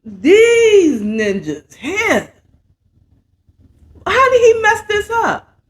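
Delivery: frustrated Saint. Retold or imitated. Saint venting at his crew, cracking mock outrage. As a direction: voice cracking high in mock exasperation, slowly drawn out. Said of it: "He's like".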